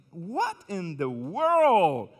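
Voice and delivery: slow, drawn-out puzzled English; imitating a confused Anglo brother; mock puzzled gringo. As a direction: lightly play a baffled Anglo churchgoer. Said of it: "los hermanos anglos dicen".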